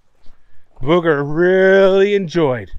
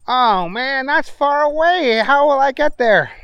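a bit higher character voice; Booger voice; 10 semitones higher than usual. Acted. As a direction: raise the voice for Booger.

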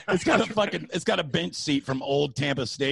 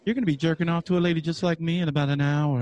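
psychic lady voice; 5 semitones higher than usual. Retold or imitated. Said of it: "she's like".

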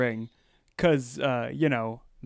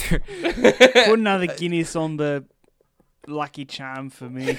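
mock Irish accent, laughing. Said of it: "No, keep going".

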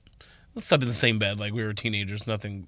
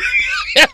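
squealing burst of laughter; high laugh; 4 dB louder than usual. Real, not acted. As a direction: shriek with laughter.

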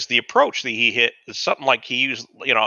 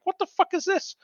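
very high, softer incredulous voice; retold disbelief; 5 dB quieter than usual. Dishwasher watching servers dump plates, softer high-pitched bewilderment. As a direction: squeak it out in mock bewilderment.

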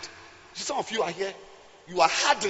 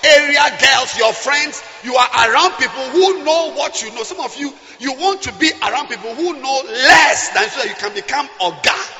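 loud fiery sermon; preaching; 3 semitones higher, 7 dB louder than usual. Acted. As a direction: preach it with full force. Preaching at full force.

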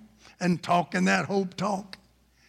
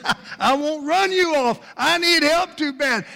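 high, urgent pleading; acted, high-pitched cry of need; desperate plea; 11 semitones higher, 5 dB louder than usual. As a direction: beg loudly, building toward a shout. Sick man pleads for help.

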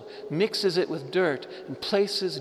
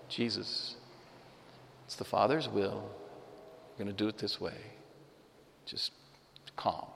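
calm Elijah; 6 semitones lower, 9 dB quieter than usual. Retold or imitated. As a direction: settle him down gently, low and quiet. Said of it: "And Elijah says".

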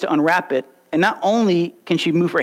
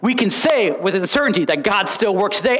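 ordinary delivery

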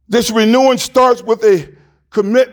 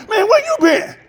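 a hint of a high, laughing old friend; 10 semitones higher than usual. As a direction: greet him like you're glad to hear him. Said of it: "some friend ... calls you".

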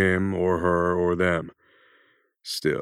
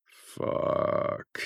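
breathy whispered fuck under the breath; whispered curse; 5 dB quieter than usual. A narrator's reading. Jack curses to himself at the sight, whispering. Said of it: "he thought to himself".

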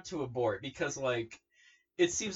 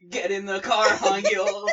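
raised, insistent repeat, louder and higher; now push it, louder and higher; the second time, louder and fed up; louder insistence; 6 semitones higher, 9 dB louder, slower than usual. Retold or imitated. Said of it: "And they're like".

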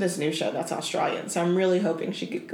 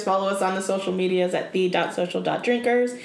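ordinary talk, nothing marked

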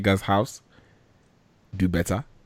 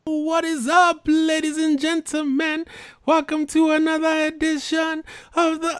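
much higher, slow, showy announcer voice; mock high intro voice. Shown as a demonstration. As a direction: put on a squeaky fake host voice. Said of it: "I don't sound like that".

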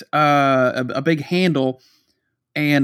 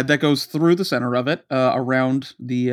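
normal talking voice

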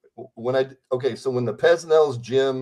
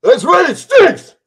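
put-on much higher, louder, slow old-man voice; old trainer's reply; 12 dB louder, slower than usual. Impersonator answers as the trainer, much higher and louder.

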